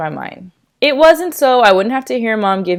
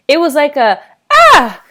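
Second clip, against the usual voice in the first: high, loud playful fake scream; mock scream; 7 semitones higher, 7 dB louder than usual. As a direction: yell Ah like a kid scaring others. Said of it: "'Ah!'".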